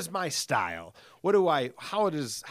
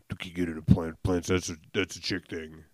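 lower, dismissive bro voice; eye-rolling dude; 6 semitones lower than usual. Retold or imitated. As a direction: scoff it like a skeptical dude. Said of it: "there's still a dude listening to this right now, just, like, rolling his eyes".

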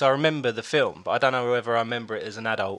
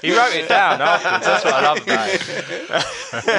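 laughing; 6 semitones higher, 6 dB louder, slower than usual. Real, not acted. Laughing about friend's gag.

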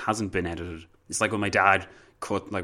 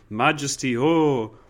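a touch of mock swooning, louder; fawning subject; 6 semitones higher, 5 dB louder, slower than usual. Subject swooning before the king, mock awe. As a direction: gasp out Majesty in awe. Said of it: "and, like".

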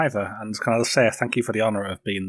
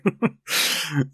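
higher-pitched dismissive laugh; amused laugh, pitched up; real chuckle; 6 semitones higher than usual. Real, not acted. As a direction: laugh it off after not caring. Dismissing the match review, then laughs.

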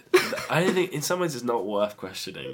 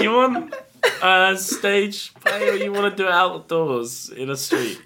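laughing; 11 semitones higher, 5 dB louder than usual. Pretend. Mocking the loaded question back.

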